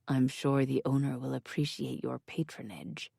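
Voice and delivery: low, soft and intimate; soft murmur. A narrator's reading. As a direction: murmur it close to her ear. Asa murmuring between kisses.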